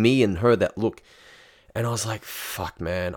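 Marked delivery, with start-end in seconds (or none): 2.2-3.2 "Fuck, man" breathed out, unvoiced and much softer; under his breath. Embarrassed on the date, he swears to himself under his breath. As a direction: swear it under your breath, barely a sound. Quiet despair at the table.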